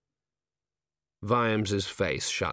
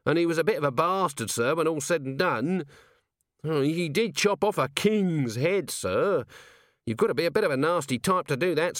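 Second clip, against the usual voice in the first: higher, hesitant, nervous little corporal; raised wheedling voice, full of ums; Nobby; 7 semitones higher than usual. A narrator's reading. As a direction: stammer it out like a nervous underling. Nobby relays the society's view.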